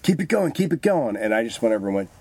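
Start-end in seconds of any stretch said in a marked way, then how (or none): 0.0-1.2 urging producers. Retold or imitated.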